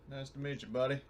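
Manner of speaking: ordinary talk, nothing marked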